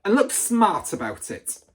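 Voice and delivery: loud, high, commanding shout; shouted order. A narrator's reading. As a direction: order him up like a sergeant. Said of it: "the Trunchbull shouted".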